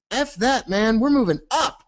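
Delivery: high cocky cartoon voice, laughing; defiant momentum voice. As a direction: brush it off, mockingly.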